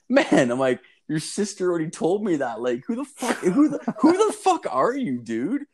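high, exasperated retold outburst; indignant confrontation. Boy confronting the stranger who took his bike, indignant.

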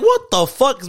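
high, loud, startled what the fuck; shocked outburst. Retold or imitated. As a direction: blurt it out in sudden alarm. Panicked reaction to sudden call.

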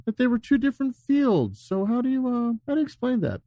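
high-pitched gotcha question; high accusing interviewer. Pretend. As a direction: corner him with a sweet accusation.

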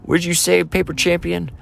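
high-pitched, indignant mock challenge; Clubber Lang. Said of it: "Clubber Lang says".

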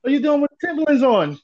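can't believe what he's wearing; teasing a new guy about his boots, incredulous. Retold or imitated. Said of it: "I'm like".

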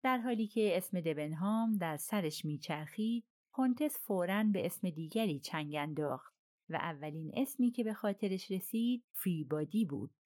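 ordinary delivery, nothing marked

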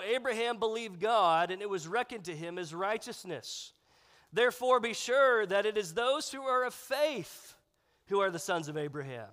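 ordinary delivery

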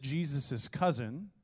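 normal talking voice

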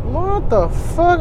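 slow, drawn-out, high rising question; incredulous retold reaction; stunned disbelief. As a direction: stretch it out in disbelief.

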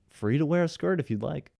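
high-pitched mock-generous sarcasm; sarcastic. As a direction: lift it up sarcastically, as if offering a real option. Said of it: "sarcastically adds that Ryan is".

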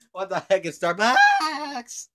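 high, slow, laughing through the question. Real, not acted. Baffled by the Starbucks bit, laughing high.

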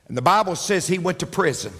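ordinary talk, nothing marked

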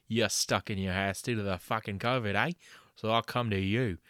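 loud, brash Aussie ad-man voice; hammed-up Aussie huckster selling dental work; fake ad pitchman. Host doing a spoof sponsor ad, loud Aussie pitchman. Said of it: "a word from our sponsors".

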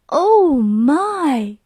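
mother overwhelmed by plants, mock dismay; dismayed groan. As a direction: moan it in despair. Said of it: "groaned his mother".

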